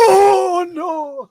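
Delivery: high, loud crying shout; hammed-up grief-stricken cry; mock wail. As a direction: wail it like a heartbroken old man. Retold grieving husband wail.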